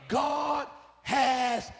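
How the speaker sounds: high, very loud, slow proclamation; full shout. Real, not acted. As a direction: roar it out slowly.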